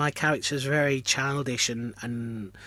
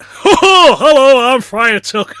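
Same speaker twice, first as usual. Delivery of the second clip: booming, high northern panto voice, laughing; stupid over-the-top Lancashire-Yorkshire ho-ho greeting; Friar Tuck; 11 semitones higher, 16 dB louder, slower than usual. Retold or imitated. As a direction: bellow it with a hearty hammy laugh. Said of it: "stupid, like, almost Lancashire, Yorkshire".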